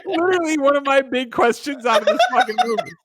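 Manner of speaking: high, squeaky talk through real laughter; laughing hard. Real, not acted. Co-host cracking up over all the cloned tuxedos, laughing. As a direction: force the words out while cracking up.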